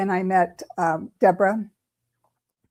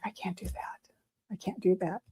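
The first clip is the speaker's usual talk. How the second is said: very soft, near-voiceless protest; whispered refusal; 11 dB quieter than usual. Retold or imitated. Inwardly refusing to pass on the boy's message, whispered. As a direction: refuse under your breath, as if to yourself. Retold dread at the request.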